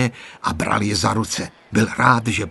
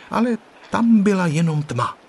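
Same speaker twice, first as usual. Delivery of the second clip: ordinary delivery, nothing marked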